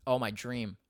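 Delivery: ordinary delivery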